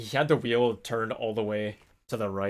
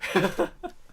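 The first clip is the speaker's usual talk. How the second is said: laughing; 9 semitones higher than usual. Real, not acted. Laughing at the funny memory.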